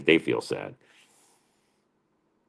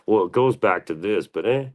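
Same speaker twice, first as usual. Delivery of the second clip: imitating know-it-alls; 5 semitones higher than usual. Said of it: "They could be like".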